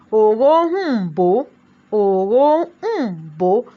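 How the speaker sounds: "òróǹbó" very slow, the middle n held as its own beat; syllables drawn out. Shown as a demonstration. Teacher showing the syllabic n, "òróǹbó" slowly sounded out. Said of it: "The n there is what".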